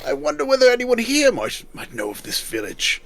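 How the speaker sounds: a lightly voiced adventurer asking the room; in-character musing. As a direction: wonder aloud to the tavern. Character asks tavern aloud.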